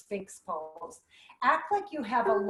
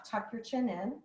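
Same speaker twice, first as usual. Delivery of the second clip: normal talking voice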